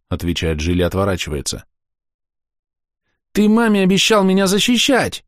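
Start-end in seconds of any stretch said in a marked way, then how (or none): 3.3-5.2 put-on high little girl crying reproach; reproachful child. A narrator's reading. Girl accuses Gil of breaking his promise, high and tearful. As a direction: throw the promise back at him.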